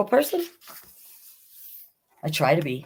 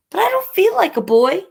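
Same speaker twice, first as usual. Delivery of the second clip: put-on much higher, louder plaintive kid; pleading child; 5 dB louder, faster than usual. Bailey answering Mother, higher plaintive child. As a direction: plead like a hurt little kid.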